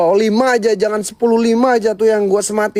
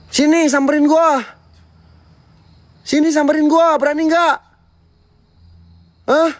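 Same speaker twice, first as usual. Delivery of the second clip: taunting dare; 7 semitones higher than usual. Real, not acted. Daring the ghost again.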